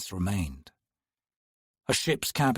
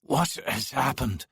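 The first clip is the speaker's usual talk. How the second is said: rasped. Acted. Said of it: "he rasped".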